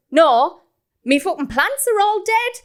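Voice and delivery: high, loud, indignant rant; acted angry telling-off. Partner scolding lad who fell off roof, acted angry telling-off. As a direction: retell an exasperated outburst. Partner rows at fallen youngster, retold.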